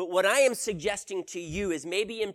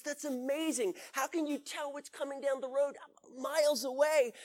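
amazed; 7 semitones higher than usual. Acted. Hiker amazed at the guide.

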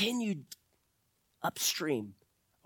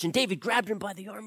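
ordinary delivery

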